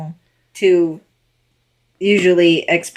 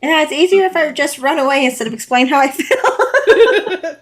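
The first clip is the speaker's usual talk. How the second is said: laughing; 6 semitones higher than usual. Real, not acted. Joking while laughing.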